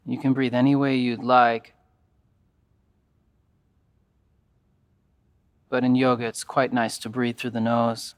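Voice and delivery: calm, even yoga nidra guidance with long pauses; guided meditation. Acted.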